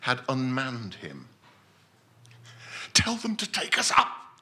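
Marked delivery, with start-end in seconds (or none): 2.9-4.4 high, pleading voice of a scared man; frightened Winthrop. Acted. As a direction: beg to be pulled up, panicking. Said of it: "he said".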